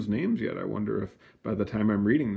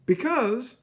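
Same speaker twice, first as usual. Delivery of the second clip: Venetia explaining; 14 semitones higher than usual. A narrator's reading. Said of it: "said Venetia".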